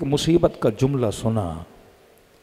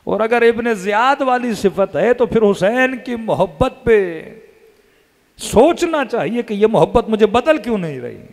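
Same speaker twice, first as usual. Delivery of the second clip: impassioned preaching; 5 semitones higher than usual. Acted. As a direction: press the point with rising fervour. Impassioned stretch of sermon. (ऊँची आवाज़ में जोशीला बयान)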